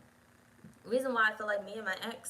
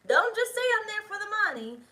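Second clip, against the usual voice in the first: high mocking voice, much louder; mock the lame excuse in a high squeal; 13 semitones higher, 7 dB louder than usual. Mocking excuse, far higher.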